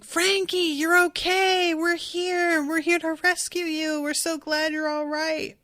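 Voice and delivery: high, held-out calls at normal volume; mock shouting. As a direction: imitate friends calling out in relief. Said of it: "They're all shouting".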